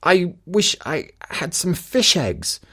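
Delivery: wife's evasive reply. Acted. Wife lies to husband.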